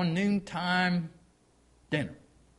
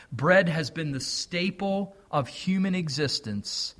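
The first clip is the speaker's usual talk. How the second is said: ordinary talk, nothing marked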